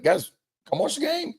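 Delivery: calling the players in, voice up high; cheerful high shout to the team; excited call. Retold or imitated. Coach calling players to watch the game, excited. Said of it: "I'm almost like".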